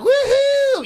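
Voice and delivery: high held woohoo; gleeful long high "woo-hoo"; mock cheering whoop. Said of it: "percent that were like".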